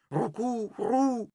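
high-pitched pigeon coo. A narrator's reading. Dolly the pigeon announces herself to Pluk, cooing. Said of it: "dikke Dolly de duif".

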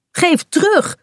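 high, indignant cry; angry shout. Acted. Girl shouting at the gull that stole her sandwich, high and angry. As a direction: yell at the thief to give it back. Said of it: "roept ze boos".

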